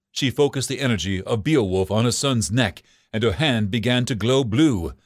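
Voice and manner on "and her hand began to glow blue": usual speaking voice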